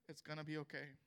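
low, soft and steady comfort; soft reassurance. Retold or imitated. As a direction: drop to a gentle, quiet promise. God's comforting words retold.

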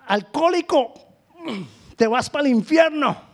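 mock hellfire accusation; condemning shout. Mimics a judgmental Bible-only believer.

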